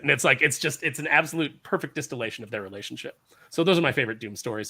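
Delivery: ordinary talk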